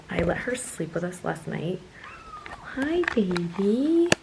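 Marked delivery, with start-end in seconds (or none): 2.8-4.1 high, slow cooing to a kitten. Real, not acted. Owner cooing hello to her new kitten, baby talk.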